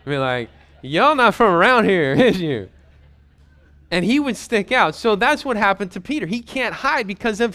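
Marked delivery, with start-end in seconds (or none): 0.8-2.7 put-on Texas accent. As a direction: ham up a Texas rodeo local. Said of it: "Andy tried to hide out at a Texas rodeo".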